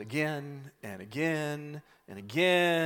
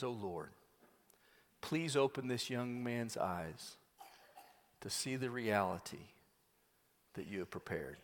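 humble prayer; 5 semitones lower, 15 dB quieter than usual. Retold or imitated. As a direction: pray quietly on bended knee. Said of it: "gets on his knees before the Lord".

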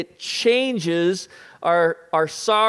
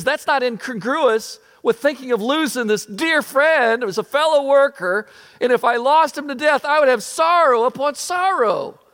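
higher-pitched, emphatic voicing of Paul; impassioned apostle's quote; 8 semitones higher, 4 dB louder than usual. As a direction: voice Paul's grief with preacherly force. Voicing Paul's grief at losing friend.

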